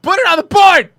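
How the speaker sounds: high, loud insistent yell; sudden high-pitched mock-angry shout; shouted command. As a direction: holler it after being refused. Shouted repeat after being refused.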